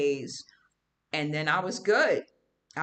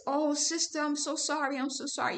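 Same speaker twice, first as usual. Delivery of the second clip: apologizing lady; 8 semitones higher than usual. Retold or imitated. Woman who elbowed her, lightly mimicked higher apology. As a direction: lightly play the embarrassed woman pleading sorry. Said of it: "She was apologizing".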